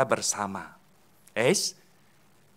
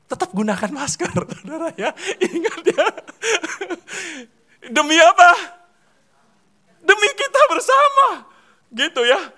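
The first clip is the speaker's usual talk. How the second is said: laughing, much higher voice urging masks; teasing the congregation with a grin; playful high reminder; 13 semitones higher, 7 dB louder than usual. Real, not acted. Preacher teasing the congregation about masks, high and laughing.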